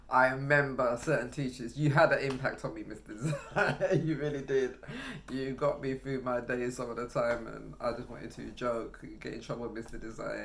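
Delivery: ordinary talk, nothing marked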